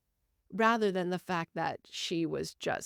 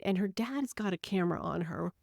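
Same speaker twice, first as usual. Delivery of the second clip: ordinary talk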